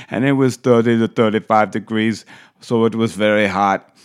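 hammed-up imitated Austrian accent; Arnold accent. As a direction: tell the tale in a mock Austrian accent. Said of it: "it's hard not to do the accent".

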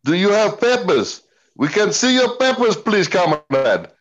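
hammed-up German guard demanding papers in a thick German accent; Colonel Klink German accent. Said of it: "of a corny German accent".

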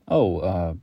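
hesitant, caught off guard; a startled homeless man stalling for words; nervous and unsure. Acted. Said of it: "clearly nervous and unsure".

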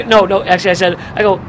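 usual speaking voice

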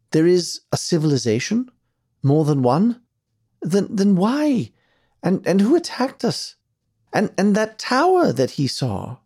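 high, excited, stammering questions; astonished questioning. A narrator's reading. Archie learns of another civilization, astonished and stammering. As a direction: tumble from one amazed question into the next, pitched high.

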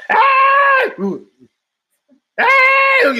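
very high, loud cartoon shriek; a wallet screaming whenever it gets opened. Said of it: "it starts screaming".

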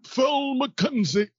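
high, slow mock Yorkshire old man. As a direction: drag it out as an elderly Yorkshire character. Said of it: "is the old man from Yorkshire".

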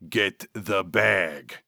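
very slow, deliberate sneer; sneering order. A narrator's reading. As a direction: order it word by word through gritted teeth. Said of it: "Vernal sneered".

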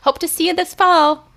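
chirpy office lady; cheerful university lady. Retold or imitated. Lightly voiced university lady wishing her well, chirpy. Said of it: "she was like".

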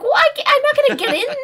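very high, loud, rushed comeback; re-enacted argument, pitch through the roof; exasperated retort. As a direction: squawk back in self-defense. Acted argument back at Frank.

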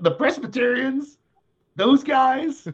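high, disbelieving mock shock; incredulous. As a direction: act stunned by the idea. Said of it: "I'm like".

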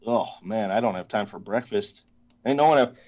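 ordinary talk

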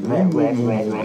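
mock cartoon teacher drone; Charlie Brown adult voice. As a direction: imitate the wah-wah grown-up from Peanuts. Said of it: "like Charlie Brown's Adults".

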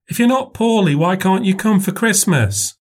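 put-on high, small child voice; little girl voice. A narrator's reading. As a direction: ask like a puzzled little girl. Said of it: "child asks".

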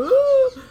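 sudden very high, very loud imitated wail; mock wail. Showing the outward crier others expect: hammed-up wail. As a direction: let out a hammed-up crying wail. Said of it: "you don't see somebody going".

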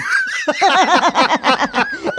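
a long high burst of laughter. Real, not acted. Host laughs at the New Hampshire voter's line. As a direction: crack up at the quote.

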